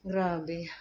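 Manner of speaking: exhausted and quiet; drained after the stairs; tired. Real, not acted. (pagod na boses)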